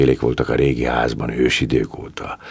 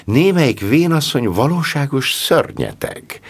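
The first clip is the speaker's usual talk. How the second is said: 1 indignant; 11 semitones higher than usual. A narrator's reading. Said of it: "szólt felháborodva".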